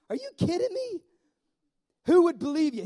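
a delighted high squeak of disbelief; incredulous squeal. Real, not acted. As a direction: shriek it like you can't believe it. Preacher marvels at the story.

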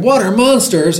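high, mildly acted storybook alarm; read-aloud yelp of alarm; alarmed cry. A narrator's reading. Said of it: "one cried".

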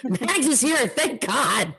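high, exclaimed relief; excited relief. Real, not acted. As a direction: exclaim with relief at a welcome arrival. Relieved greeting as absent co-host arrives.

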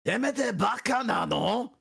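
high, hammed-up yelled insult; mock angry shout. Student given the naughty phrase to try, mock furious shout. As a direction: yell it like an outraged cartoon brawler.

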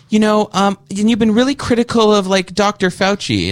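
high, whiny mock voice, slowing at the end; prissy squeaky parody; mocking high voice of the host. Guest mocking the host's Fauci question in a high voice. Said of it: "really good impersonation of the show".

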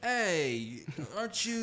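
a bad Australian accent, played for laughs; horrible Australian accent. Imagining the Empire of the Sun guy greeting him, in a bad Australian accent. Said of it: "That's a horrible, um, accent".

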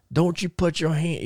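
imitating a high country Chattanooga Tennessee accent; Terry Gordy's Tennessee accent. Terry Gordy warning off Bill Mercer, mock Tennessee country accent. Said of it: "how Terry talked in that, in that re-, real country Ch-, uh, Chattanooga, Tennessee, uh, accent".

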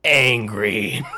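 one sour, drawn-out note; mock-sour one-word verdict; Chris Farley, sour. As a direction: imitate curt, bitter anger. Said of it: "in just one sour note".

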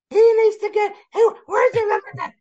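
hammed-up shriek of an angry fan; mock screaming fan. The fan yells at security, high mock scream. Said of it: "Start screaming at me".

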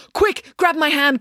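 desperate shouted urging; urgent cry. A narrator's reading. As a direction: cry out to someone in danger. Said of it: "cried Loretta".

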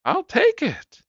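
high, loud excited shout; old lady shouting. A narrator's reading. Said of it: "imagine the little old lady shouting".

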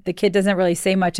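usual speaking voice

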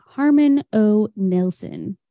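usual speaking voice

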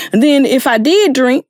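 ordinary talk

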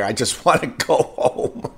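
half-laughing wish to escape; breaking into laughter mid-sentence; laughing plea. Retold or imitated. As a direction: laugh through a retold complaint. Laughs retelling wish to leave.

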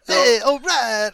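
slow, high, loud greaser drawl; Travolta-style heartthrob. Acted. Player voicing the handsome NPC like a greaser, loud and slow. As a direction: stretch it out like a 70s cool guy. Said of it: "I can't do the voice like I want to".

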